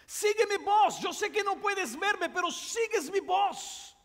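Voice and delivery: urgent father's shout through the fire. Acted. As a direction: call him toward your voice. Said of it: "el padre continaba gritando".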